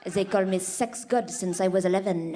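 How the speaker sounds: put-on low, boastful French-accented Frenchman; self-proclaimed lover, French accent. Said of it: "and he's like".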